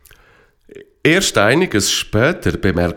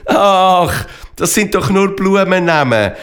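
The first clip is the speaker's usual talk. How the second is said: amused, high and louder dismissal; laughing explanation; 9 semitones higher, 3 dB louder than usual. A narrator's reading. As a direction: brush off the fright with amusement. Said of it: "erklärt der Fralpi lachend".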